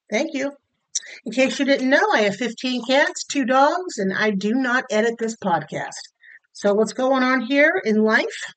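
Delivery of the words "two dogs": ordinary talk, nothing marked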